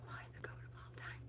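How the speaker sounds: soft fast whisper. Real, not acted. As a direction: whisper it to yourself. Rereading name to herself.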